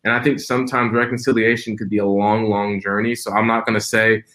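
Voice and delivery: usual speaking voice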